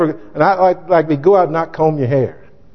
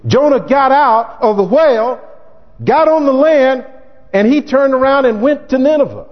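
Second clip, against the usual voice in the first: preaching; 8 semitones higher than usual. Acted. Preacher building to full force.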